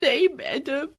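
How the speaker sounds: put-on girl crying to her teacher, slow and high. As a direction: sob it out as a despairing girl. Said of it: "मेधा रोते हुए बोली".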